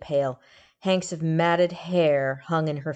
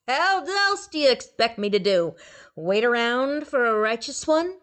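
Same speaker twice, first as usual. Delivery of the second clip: higher, sneering swamp ghoul; jeering ghoul; mocking ghoul; 7 semitones higher, 3 dB louder than usual. A narrator's reading. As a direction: laugh at the god's piety. Ghoul mocks god.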